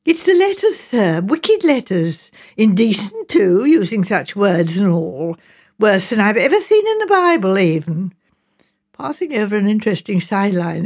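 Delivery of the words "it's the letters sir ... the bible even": scandalised mother. Acted. Mrs Baker tells the gentleman of wicked letters, scandalised. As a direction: draw out each shocking detail.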